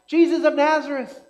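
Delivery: a loud, high call for Jesus; a hammed-up cry over the noise. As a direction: call out to him across the crowd. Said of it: "But does he cry out".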